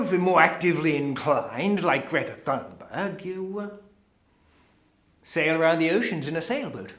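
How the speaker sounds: normal talking voice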